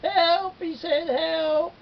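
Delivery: very high, loud cry; trapped spider yelling help; calling for help. Acted. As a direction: shout for help through the wall.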